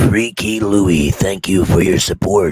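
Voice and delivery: slow, deep trailer voice; movie announcer voice. Pretend. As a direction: thank him like a film trailer. Trailer voice thanks chatter.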